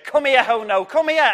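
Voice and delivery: high, loud call; shouting from afar. Acted. Master shouting for his servant, loud high call. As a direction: call him from across the yard. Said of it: "this noise shouting in the distance".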